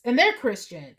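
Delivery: usual speaking voice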